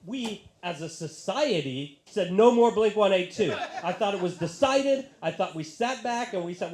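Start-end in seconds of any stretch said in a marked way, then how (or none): 2.3-3.5 mock decree. Society's verdict, mock proclamation.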